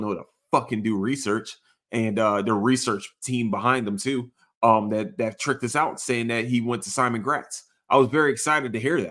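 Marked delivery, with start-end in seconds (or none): none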